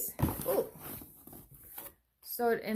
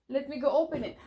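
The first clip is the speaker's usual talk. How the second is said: normal talking voice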